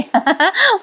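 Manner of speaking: can't help laughing at the line; real high laugh. Real, not acted. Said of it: "मला फार हसू आला".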